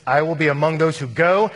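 volunteer boldly, projecting to the whole crowd; ringing pledge at full volume; bold declaration. Acted. Acted bold missionary declaration.

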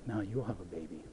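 hushed, kind reassurance; gentle. Acted. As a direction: say it tenderly to a bitter woman. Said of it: "gently say".

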